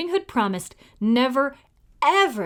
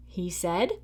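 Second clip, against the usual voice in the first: usual speaking voice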